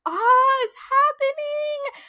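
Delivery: very high, squealing with delight; excited squeal. Retold or imitated. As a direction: squeal like a fan whose ship just sailed. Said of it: "And I'm like".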